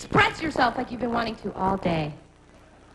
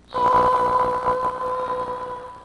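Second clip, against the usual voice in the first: mock gasp; 13 semitones higher than usual. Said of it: "this magical, mysterious voice came".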